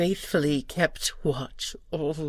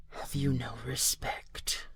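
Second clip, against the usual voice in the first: an intense hushed whisper; whispered reproach; 8 dB quieter than usual. Acted. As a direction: hiss the reproach under your breath. Guardian reproaches the lord.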